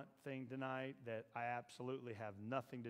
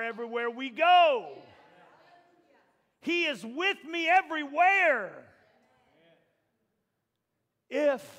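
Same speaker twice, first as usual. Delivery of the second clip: higher and louder, pushing the crowd; preaching at full force; 7 semitones higher, 14 dB louder than usual. Acted. Preacher rousing the congregation, full force. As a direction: preach it, rising.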